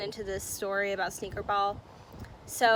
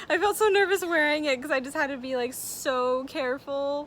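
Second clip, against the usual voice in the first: real laughter through the words, higher and louder, rushing; laughing while talking; 5 semitones higher, 3 dB louder than usual. Real, not acted. [name] laughing to viewers about the nerve-racking necklace. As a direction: laugh nervously as you remember it. Recalls nervously wearing costly necklace.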